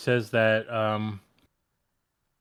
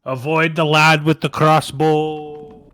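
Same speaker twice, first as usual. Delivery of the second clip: slow, loud, pitched-up warning; urgent yell; 4 semitones higher, 8 dB louder, slower than usual. Acted. Player warns against the crossbowman as vision fades, slow loud yell.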